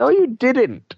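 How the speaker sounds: put-on high squeaky kid voice; childish squeaky retort. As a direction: snap back like a bickering child. Childish playful quarrel.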